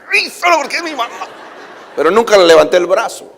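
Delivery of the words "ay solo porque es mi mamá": mock grumble. Boy fuming at the pillow.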